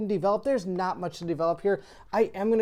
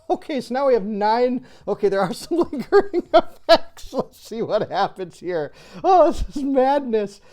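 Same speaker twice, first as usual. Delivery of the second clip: higher, louder, through real laughter; laughing in disbelief; 5 semitones higher, 8 dB louder than usual. Real, not acted. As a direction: crack up helplessly while trying to carry on. Laughs at disastrous game losses.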